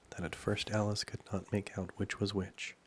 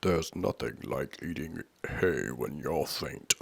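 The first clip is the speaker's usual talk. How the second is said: low, slow king; king's voice; 5 semitones lower, 4 dB louder, slower than usual. A narrator's reading. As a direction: speak low and leisurely as the king. King munching, voiced in story.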